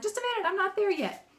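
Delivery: high-pitched, flustered protest; acted exasperated mom stalling; harried mother. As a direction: fend off the pestering, pitched high. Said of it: "I'm tired of it".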